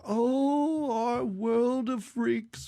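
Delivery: put-on slow, wavering old man; a frail elder announcing doom. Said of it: "doing the old man voice".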